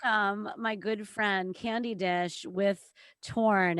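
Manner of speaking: normal talking voice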